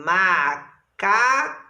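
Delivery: "macaco" slowly chopped into ma, ca, co; syllable by syllable. Shown as a demonstration. Teacher clapping out syllables for pupils, slow and separated. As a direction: say "macaco" one clap per syllable. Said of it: "ou eu bato palma".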